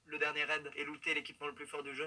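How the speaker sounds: mock-moving tribute to mothers; solemn intro narration. Streamer opens the video with a mock-solemn tribute.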